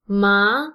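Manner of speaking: "Ma" with an upward glide; second tone. Shown as a demonstration. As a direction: lift the pitch upward through "Ma". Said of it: "is the second tone".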